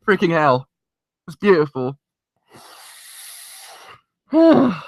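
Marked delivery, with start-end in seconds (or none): 4.3-4.8 tearful sigh. Real, not acted. Moved after an emotional episode.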